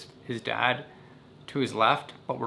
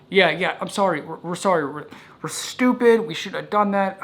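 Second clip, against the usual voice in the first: high, loud, frantic sorry; panicked apology; 7 semitones higher, 5 dB louder than usual. Retold or imitated.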